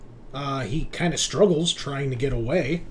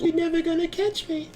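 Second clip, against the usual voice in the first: mock taunt. GM voices greased fleeing guy.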